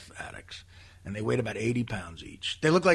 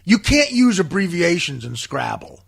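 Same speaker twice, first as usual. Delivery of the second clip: louder, higher preachy declaration; self-righteous; 6 semitones higher, 9 dB louder than usual. Retold or imitated. Woman lecturing players on Scrabble rules, self-righteous. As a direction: lay down the law smugly. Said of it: "on her fucking self-righteous soapbox".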